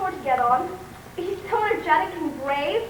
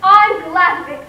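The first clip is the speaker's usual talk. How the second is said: much louder, proud snap back; defiant retort; 9 dB louder than usual. Acted. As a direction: throw it back at her.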